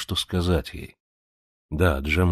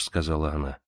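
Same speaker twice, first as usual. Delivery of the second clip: ordinary delivery, nothing marked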